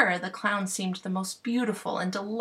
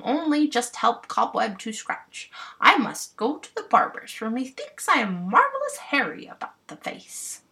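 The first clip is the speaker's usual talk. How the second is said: louder, higher bumpkin giving orders; bossy clown voice; 5 semitones higher, 4 dB louder than usual. Acted. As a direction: boss the fairies like a proud clown.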